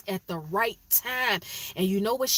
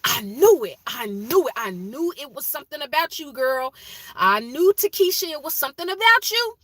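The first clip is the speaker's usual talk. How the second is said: excited I knew it; 6 semitones higher, 6 dB louder than usual. Retold or imitated. Guessing the engagement.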